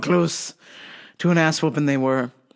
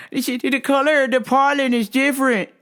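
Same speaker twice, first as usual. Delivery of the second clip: mock customer voice, much higher; 8 semitones higher than usual. Worker mimicking customer insisting the colour differs, higher. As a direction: explain it like the puzzled customer, pitched up.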